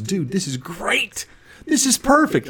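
high, delighted voice rising to a loud yelp on perfect; cracking up at the bad news; laughing brother. Brother laughing on the phone, delighted. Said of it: "He starts dying laughing".

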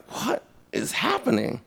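high, hushed disbelief; whisper-ish stunned question; hint of amazed confusion; astonished. Retold own astonishment.

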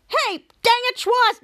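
high, loud mock outburst; exasperated scolding. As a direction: yell at the prankster, voice shooting up. Captain Man scolds Schwoz for prank.

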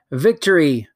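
loud gloating shout of victory; triumphant cry. Acted. Sister gloats to the queen, loud triumph. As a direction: crow "Victory!" loudly, triumphant. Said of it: "wicked eyes upon me and said".

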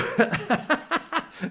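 playful crazy-professor laugh; mad scientist cackle. Pretend. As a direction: cackle over a bubbling experiment. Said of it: "crazy scientist in his lab".

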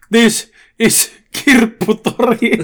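slow, high-pitched mock war cry; acted shout, word by word; battle cry. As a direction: stretch each word into a drawn-out yell.

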